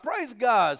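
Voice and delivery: loud, high praise; jubilant. Retold or imitated. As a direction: cry out praise God. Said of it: "And I'm like".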